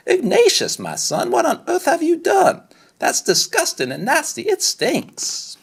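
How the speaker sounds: high, shrill-edged mom recoiling in disgust; disgusted mother. Acted. Mother discovering the diapers, high and disgusted. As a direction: squeal it like a grossed-out mom.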